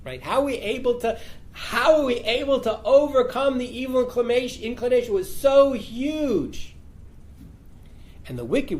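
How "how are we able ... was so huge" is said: high, strained mock lament of the righteous; mock wailing lament. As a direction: wail it like a stunned, weeping saint. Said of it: "The righteous weep and say".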